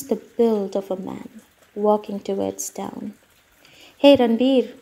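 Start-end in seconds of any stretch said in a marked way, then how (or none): 4.0-4.7 a loud call down the road; shouted. A narrator's reading. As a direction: call out to a friend ahead. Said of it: "shouted Somi".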